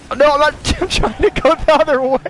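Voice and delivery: high, laughing protest; laughing panic. Real, not acted. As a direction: squeal it out through laughter. Laughing while panicking in game.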